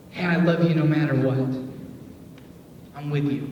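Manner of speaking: mean every word; genuine warmth. Shown as a demonstration. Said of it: "genuine, all the way real".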